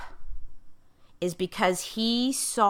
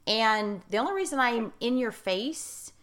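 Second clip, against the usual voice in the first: usual speaking voice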